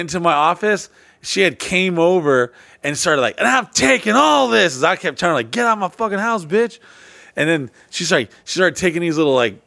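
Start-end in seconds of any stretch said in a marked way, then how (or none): 3.4-4.8 high mock-angry declaration; imitating mad girlfriend. Girlfriend seizing gifts she bought, mock furious. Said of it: "she'd get so mad". 5.5-6.8 retold angry order. Acts out quarrel with girlfriend.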